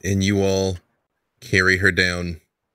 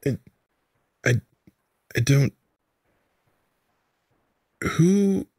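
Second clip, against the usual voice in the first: confused; 5 semitones higher than usual. Acted. Confused woman just woke.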